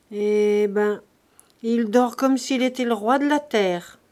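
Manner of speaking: ordinary delivery, nothing marked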